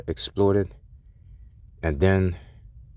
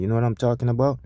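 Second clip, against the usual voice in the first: ordinary delivery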